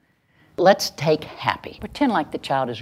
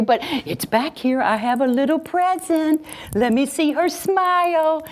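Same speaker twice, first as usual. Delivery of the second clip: put-on high, sing-song baby talk to a sad child; cooing grandma; 12 semitones higher than usual.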